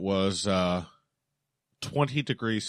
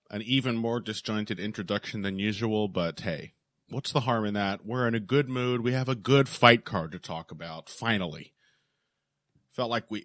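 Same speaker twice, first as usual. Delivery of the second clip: ordinary talk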